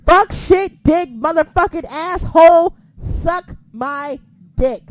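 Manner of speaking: loud, shouted string of curses; a defiant shout of curses at the critic; defiant swearing burst. Real, not acted. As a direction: yell every swear word in defiance.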